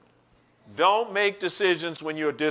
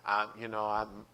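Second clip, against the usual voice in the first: low, soft, hesitant mumble; imitated sheepish husband; 9 semitones lower, 10 dB quieter than usual. Husband told by counselor to tell his wife, sheepish and hesitant. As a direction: falter quietly into an admission.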